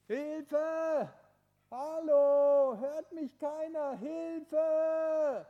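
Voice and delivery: loud high cries for help; calling for help. Acted. Tobi stranded on the ledge, shouting for help. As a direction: shout up the mountain for someone to hear.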